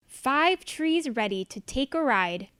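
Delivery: usual speaking voice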